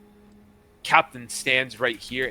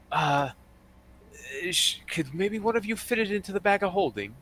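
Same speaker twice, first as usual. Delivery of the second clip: higher-pitched, hesitant request; hesitant female character; 6 semitones higher than usual. Acted. Woman asking the party to stow the club, hesitant higher voice. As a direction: ask timidly in a higher character voice.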